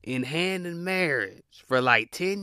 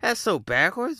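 much higher, stunned what the hell; incredulous; 8 semitones higher than usual. Retold or imitated. Seeing women propose, higher stunned disbelief. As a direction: rise up in disbelief. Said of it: "I'm like".

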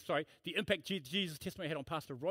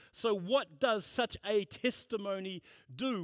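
preaching; 3 semitones higher than usual. Acted. Pastor proclaiming Christ to his congregation, fervent preaching. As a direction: preach it with full conviction from the pulpit.

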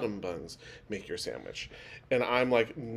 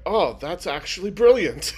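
delighted approval; 5 semitones higher, 6 dB louder than usual. Retold or imitated. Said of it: "they were just like".

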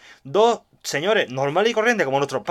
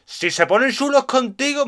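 lightly voiced doorman, a little slow and higher; tough doorman; 7 semitones higher, slower than usual. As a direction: play a hint of a swaggering bouncer. Doorman offering protection.

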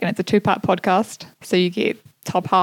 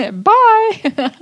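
laughing goodbye; 13 semitones higher, 5 dB louder than usual. Real, not acted. Laughing sign-off.

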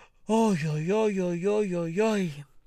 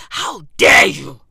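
normal talking voice

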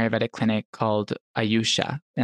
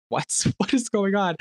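high, laughing mock bewilderment; confused surprise; 10 semitones higher than usual. In the Indian shop, bewildered by the secret call, laughing. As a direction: laugh out a baffled question. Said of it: "I was just like".

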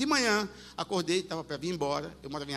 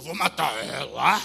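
demon voice; 7 semitones lower than usual. Retold or imitated. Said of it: "Aí o demônio começou".

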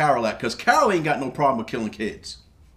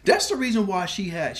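ordinary talk

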